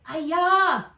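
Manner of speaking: high, loud falling scream. A narrator's reading. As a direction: scream as you drop. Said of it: "screamed Nat".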